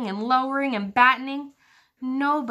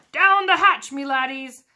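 high, loud table-pounding bellow; captain yelling a toast at dinner; pirate yell; 5 semitones higher, 6 dB louder than usual. A narrator's reading. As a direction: roar it as a toast. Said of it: "pounded his fist on the table and yelled".

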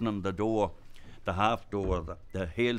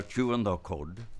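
ordinary delivery

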